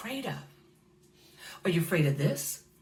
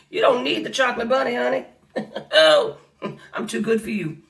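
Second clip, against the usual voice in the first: sassy retort; 4 semitones higher, 6 dB louder than usual. Retold or imitated. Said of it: "You know what I said?".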